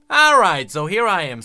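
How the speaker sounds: normal talking voice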